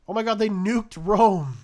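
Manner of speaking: loud, high, slow stunned shout; shocked exclamation. Real, not acted. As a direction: gasp it out in disbelief. Shock at nuclear strike in game.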